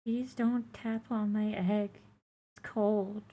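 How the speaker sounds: put-on high, soft, faint baby creature; small high creature voice. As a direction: plead quietly like a fragile hatchling.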